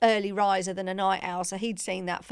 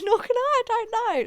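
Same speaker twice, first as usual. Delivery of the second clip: much higher, giggly and helpless; laughing; 13 semitones higher, 3 dB louder, faster than usual. Retold or imitated. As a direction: squeak it out mid-laugh.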